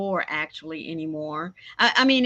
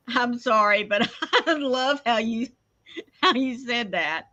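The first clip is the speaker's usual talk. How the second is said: ordinary talk, nothing marked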